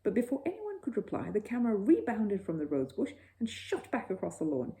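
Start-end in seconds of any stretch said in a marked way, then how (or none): none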